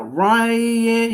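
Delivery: slow, sustained mock player introduction; ballpark announcer imitation. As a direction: draw the name way out for the crowd. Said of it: "you did your Ryan".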